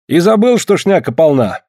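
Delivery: high, pointed question; teasing challenge. Acted. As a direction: needle him with the question.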